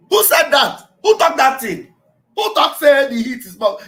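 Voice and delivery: loud, high, indignant demand; outraged shouted questioning; acted angry challenge. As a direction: confront the culprit at full voice.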